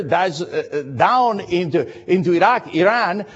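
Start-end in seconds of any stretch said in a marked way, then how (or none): none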